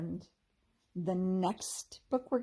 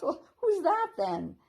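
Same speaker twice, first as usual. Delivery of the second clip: high, louder, slow teasing fairy voice; playful fairy; 10 semitones higher, 8 dB louder than usual. A narrator's reading. Ruby pointing out the hiding squirrel, playful high tease. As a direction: draw it out, amused.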